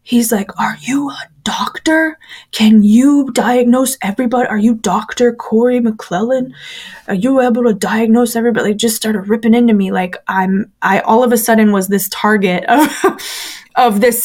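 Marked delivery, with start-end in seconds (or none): 0.6-8.7 mild mock tirade, stressing the accusing questions; reenacted scolding, incredulous questions fired in a row; retold angry screaming. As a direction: imitate an indignant dressing-down without shouting. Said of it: "started screaming at me".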